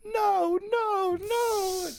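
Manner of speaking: very high, drawn-out wail of protest; Carlton. Retold or imitated. Seeing Tyra hug Will, high mock wail. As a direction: wail each "no" in mock dismay. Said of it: "and Carlton's like".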